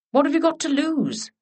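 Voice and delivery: high, insistent challenge; demanding. Acted. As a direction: press her for an answer. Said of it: "demanded Lady Holly".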